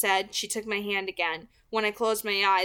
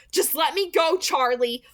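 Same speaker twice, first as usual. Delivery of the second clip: through gritted teeth; 7 semitones higher, 6 dB louder than usual. A narrator's reading. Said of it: "I said through my teeth".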